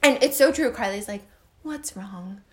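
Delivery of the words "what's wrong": imitated soft, unruffled questioning; calm partner. Carly asking why she's yelling at the baby, calm and hushed. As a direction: ask it very softly and gently. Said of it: "she's very calm with her".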